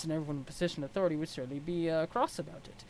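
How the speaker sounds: ordinary talk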